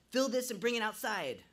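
loud, high commanding order. A narrator's reading. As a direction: give a firm command. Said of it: "I commanded".

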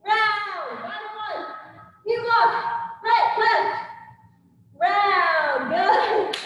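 boxing coach calling combos. Acted. Instructor calling combo reps.

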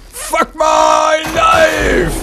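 exasperated shout. Real, not acted.

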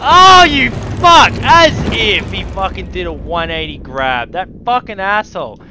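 loud outraged cursing; furious scream. Real, not acted. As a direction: scream it at the enemy. Enemy catches him.

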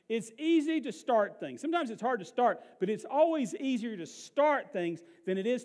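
loud, high, emphatic sermon delivery; full-force pulpit proclamation; impassioned preaching. Real, not acted. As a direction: drive each point out to the crowd.

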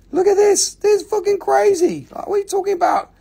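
very high hammed-up squeal; over-the-top excitement. Seller showing viewers a crazy rock, squealing excitement. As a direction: go wild over it in a squeaky pitch.

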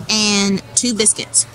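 ordinary talk, nothing marked

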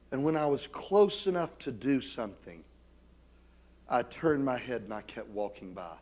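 ordinary talk

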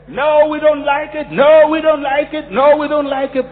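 high-pitched mock student protest. Voicing students refusing the school food.

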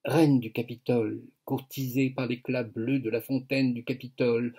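ordinary talk, nothing marked

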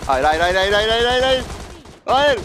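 loud, rising cries of no; desperate no. Real, not acted. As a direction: plead loudly as the fight is lost. Losing a fight, desperate cries. (çaresizce bağırarak)

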